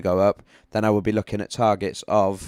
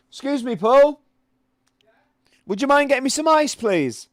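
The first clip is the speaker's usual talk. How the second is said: high, loud call to someone far off; raised voice, much higher and louder; real shout; 5 dB louder than usual. Real, not acted. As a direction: holler a polite request across the house. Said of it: "Sorry to shout".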